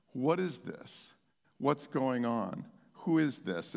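low, slow, hushed questioning; shaken and troubled. Acted. Troubled city of Jerusalem asking who this is, hushed unease. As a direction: ask it with uneasy, subdued wonder. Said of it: "They were shaken and troubled".

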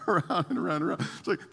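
ordinary talk, nothing marked

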